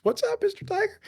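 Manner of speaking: very high, silly falsetto hello; playful greeting. Pretend. Imagined chat with a tiger in Eden, playful greeting.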